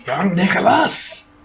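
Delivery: slow, high call out. Pretend. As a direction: hail him like a herald. Mock calling out a name.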